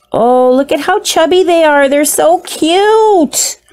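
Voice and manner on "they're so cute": slow gush. Real, not acted. Gushing over isopods.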